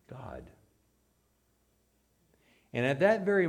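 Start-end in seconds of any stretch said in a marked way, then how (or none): 0.1-0.5 breathy whispered answer; solemn whisper. Real, not acted. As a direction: let the word fall in a whisper. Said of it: "answering own question, hushed".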